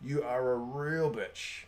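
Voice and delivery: slow, quiet, drawn-out retort; inner retort. Retold or imitated. Thinking a slow quiet reply to the neighbour. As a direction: savour each word under the breath. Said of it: "I'm thinking".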